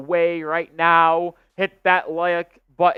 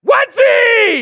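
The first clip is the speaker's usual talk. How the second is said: explosive high-pitched commentator scream; going nuclear; 11 dB louder than usual. Real, not acted. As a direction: erupt at a freak basketball play. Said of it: "I go nuclear when we get a wedgie".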